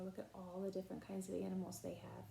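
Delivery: usual speaking voice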